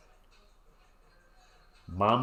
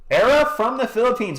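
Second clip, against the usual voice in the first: high, loud greeting; cheerful shoutout; 10 semitones higher, 4 dB louder than usual. Real, not acted. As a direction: call a viewer's name happily. Shoutout to a viewer.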